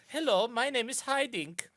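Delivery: high, loud mock Dutch showman; hammed-up Dutch TV entertainer. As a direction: play a cheesy Dutch variety host. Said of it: "all of Dutch entertainment is that".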